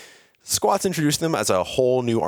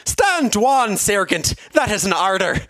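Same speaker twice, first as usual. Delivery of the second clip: high, louder character voice with a put-on Irish lilt; Captain Cherry's stern order; 13 semitones higher, 5 dB louder, slower than usual. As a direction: give a hammed-up command in an Irish accent. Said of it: "came the reply from the captain".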